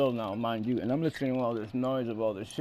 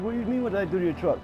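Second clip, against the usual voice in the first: arguing back fast; 6 semitones higher, much faster than usual. Retold or imitated.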